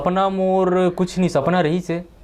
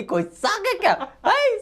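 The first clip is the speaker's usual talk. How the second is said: high squeal of a joke; squeaky joking voice. Real, not acted. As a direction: squeal it playfully.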